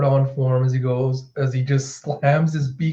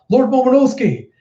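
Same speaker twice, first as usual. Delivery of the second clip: high, loud cry to his lord; retold urgent call; 11 semitones higher, 5 dB louder than usual. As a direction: shout the name in acted alarm. Retainer calls out to lord.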